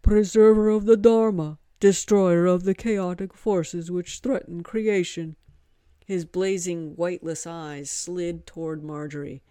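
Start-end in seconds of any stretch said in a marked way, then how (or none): 0.0-5.4 loud, solemn Hanuman proclaiming; reverent monkey god. Acted. Monkey god reverently naming Vishnu, loud and solemn. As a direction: proclaim it like a sacred title. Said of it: "Hanuman reverently finished".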